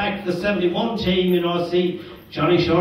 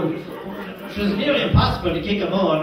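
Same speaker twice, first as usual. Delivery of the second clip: ordinary delivery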